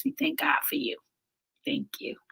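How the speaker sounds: soft unvoiced whisper. Real, not acted. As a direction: breathe the thanks in a whisper. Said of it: "reading Aunt Karen's comment".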